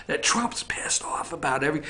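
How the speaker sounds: hushed, secretive whisper; mock whisper. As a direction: drop to a whisper. Whispering quoted phrase.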